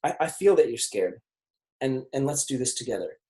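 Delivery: soft, quiet reassurance; an arm round the shoulder, gently; gentle comfort to a scared client; reassuring. Retold or imitated. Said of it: "you put your arm around them".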